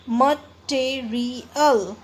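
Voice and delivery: Material slowly as mat-te-ri-al; four separate beats on material, a gap after each; syllable by syllable. Shown as a demonstration. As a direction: break material up as it is spelt. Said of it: "इसमें आपको four break आएंगे".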